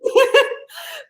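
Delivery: a high squealing burst of laughter. Real, not acted. Laughing with her co-host about grandmas, high laugh. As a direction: crack up out loud.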